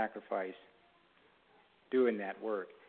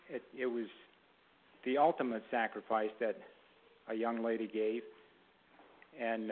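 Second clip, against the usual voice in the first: usual speaking voice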